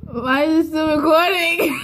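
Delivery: embarrassed laugh through words; giggling, caught off guard. Real, not acted. Caught still recording.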